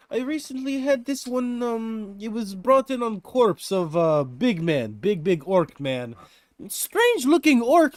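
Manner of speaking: put-on excited Russian-accented blacksmith telling of a strange orc; thick Russian accent. Giantess explains the orc corpse in a thick Russian accent. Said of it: "with a thick accent".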